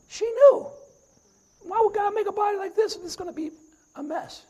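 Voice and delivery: usual speaking voice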